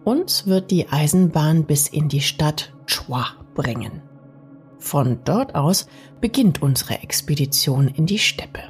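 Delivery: plain reading voice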